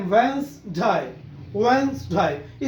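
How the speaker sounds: वेडनेसडे drawn out slowly as wenz-day; slow correct model. Shown as a demonstration. Teacher repeating the word slowly for students. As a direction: slowly say वेडनेसडे without the middle d. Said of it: "W E N".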